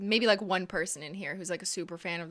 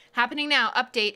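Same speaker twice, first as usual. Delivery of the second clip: fast, loud, higher announcement of the step; excited live update; 3 semitones higher, 9 dB louder, faster than usual. Real, not acted. To viewers, announcing the robot starting, excited. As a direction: call out each step as it happens.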